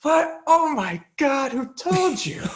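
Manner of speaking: very high, drawn-out exclamation; mock shock. Repeating the dad's shocked line for laughs, mock shock. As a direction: play up the appalled disbelief, stretched out.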